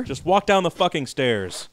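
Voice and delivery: slow, loud, mock-stern command; a hammed-up order, slow and loud; mock bossy order. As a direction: bark it out like an exasperated boss. Bossing the player through the mansion.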